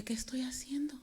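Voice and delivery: hushed, breathless self-question; whisper. Retold or imitated. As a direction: whisper it to yourself, stunned. She stops herself mid-drive. (susurro asombrado)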